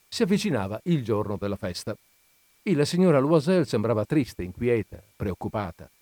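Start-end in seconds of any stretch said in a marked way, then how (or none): none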